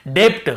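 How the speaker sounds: "डेप्ट" with an audible p/b before the t; B wrongly sounded. Shown as a demonstration. Teacher shows reading without the rule, "डेप्ट" with b sounded. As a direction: close the lips before the t in "डेप्ट". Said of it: "ये नहीं होता तो हम कैसे पढ़ते".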